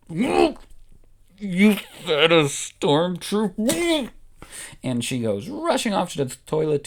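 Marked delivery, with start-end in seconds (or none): 0.1-4.1 horrified disbelief. Acted. Leia horrified question.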